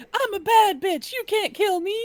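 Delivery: high, loud, put-on sassy voice; sassy video quote. Quoting a viral video to friends, loud high sassy voice. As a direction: belt it out like a tough diva daring someone. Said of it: "it's like that video".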